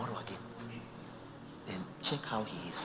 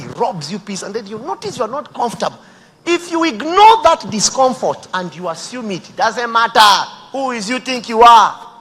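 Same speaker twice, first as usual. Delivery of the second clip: fiery sermon shout; full-force preaching; 11 semitones higher, 19 dB louder than usual. Acted.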